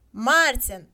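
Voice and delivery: loud call across the crowd; calling the next name loudly. A narrator's reading. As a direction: shout the name to the square. Calling names at lottery.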